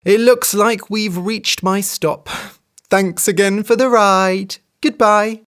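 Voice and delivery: sweetly waving goodbye; cheerful hare. A narrator's reading. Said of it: "said the hare, rubbing her stomach".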